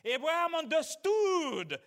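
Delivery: loud, high, fervent sermon voice; preaching at full force. Acted. Preacher driving the point home to the congregation, loud and high. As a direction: preach it hard and lift the pitch.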